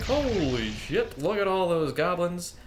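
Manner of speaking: startled. Retold or imitated. Player reliving seeing the goblin horde, startled and drawn out. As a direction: act out spotting the horde. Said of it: "and now I'm like".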